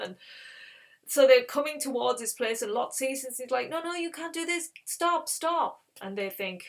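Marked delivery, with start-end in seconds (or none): 3.7-5.8 high, urgent retold plea; alarmed protest. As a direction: try to halt an advancing crowd. Lot tries stopping the mob.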